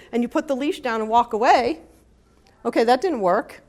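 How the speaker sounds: normal talking voice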